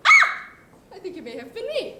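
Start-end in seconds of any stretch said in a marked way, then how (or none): 0.1-0.5 high, loud mock scream. Retelling who screamed when the shots rang, mock shriek. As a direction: yelp it high like a sudden fright. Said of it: "Then somebody screamed".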